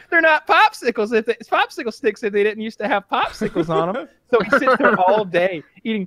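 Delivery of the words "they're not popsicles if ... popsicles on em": high-pitched, defensive excuse, half laughing at it; mock indignant protest. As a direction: imitate a defensive man's silly argument. Retells Randy's silly protest.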